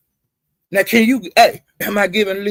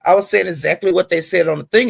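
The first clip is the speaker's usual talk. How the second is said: normal talking voice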